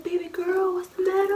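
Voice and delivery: high-pitched cooing impression of dad; concerned dad. Dad asking his crying girl, high and concerned. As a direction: coo it like a worried parent.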